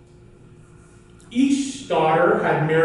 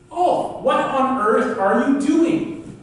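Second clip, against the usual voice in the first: much higher, slow exclaim; alarmed wife; 7 semitones higher, slower than usual. Acted. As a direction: cry out high at your husband's folly. Wife sees Ulf's burnt hand.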